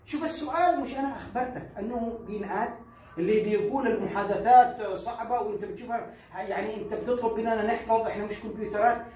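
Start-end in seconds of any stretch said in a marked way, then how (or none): none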